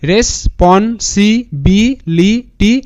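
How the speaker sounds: very slow, a pause after each syllable. Shown as a demonstration. Teacher models how students should pronounce it, syllable by syllable. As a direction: sound out responsibility one syllable at a time. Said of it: "এরকম করে উচ্চারণটা করবে".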